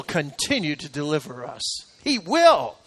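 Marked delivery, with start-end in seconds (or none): none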